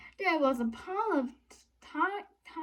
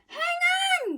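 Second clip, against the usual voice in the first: high, louder yell; 5 dB louder than usual. A narrator's reading. Asia yelling to friends on a bumpy road. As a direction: shout the warning over the bumps. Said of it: "yelled Asia".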